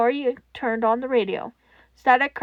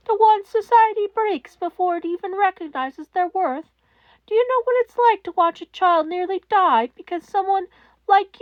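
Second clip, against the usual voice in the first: higher, louder demanding question; furious question; 6 semitones higher, 3 dB louder than usual. A narrator's reading. Netsu confronting Toshinori, high and furious. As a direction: demand an answer he can't give.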